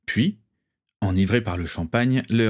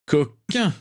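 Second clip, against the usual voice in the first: high playful jab; teasing; 7 semitones higher than usual. Acted. Friend ribbing Nott, playful high tease. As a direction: tease him like a rascal.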